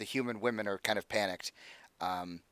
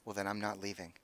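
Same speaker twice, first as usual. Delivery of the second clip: quiet defiance; 2 semitones lower, 9 dB quieter than usual. Acted. Isabel stubbornly refuses.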